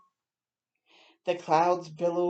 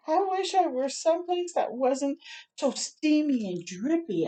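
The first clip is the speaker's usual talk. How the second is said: whiny wish; 8 semitones higher than usual. Acted. Alice complains again.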